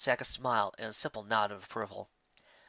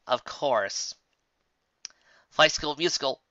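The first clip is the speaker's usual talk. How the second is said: ordinary delivery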